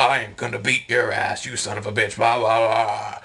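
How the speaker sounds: mock chest-out threat; lightly voiced blustering bully; puffed-up tough guy. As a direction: imitate a man puffing himself up. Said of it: "puts on his, like, big chest".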